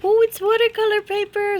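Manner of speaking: very high, loud delighted exclamation; real excited squeal; pleased surprise. Real, not acted. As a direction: burst out happily at the discovery.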